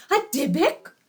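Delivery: startled rising question; shocked question. Acted. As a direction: gasp it out in disbelief. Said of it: "exclaimed Leah's parents".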